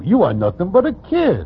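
ordinary delivery

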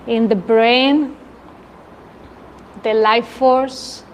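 unhurried meditation voice with long pauses; guided meditation. Real, not acted. Leader invites the group to feel energy in the brain, guided meditation. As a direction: let each phrase settle into silence, easing down at the end.

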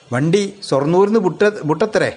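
ordinary delivery, nothing marked

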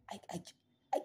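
halting stutter on I; stutter, stuck on the first word; stammering. Acted. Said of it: "I stammer because I can't even talk well".

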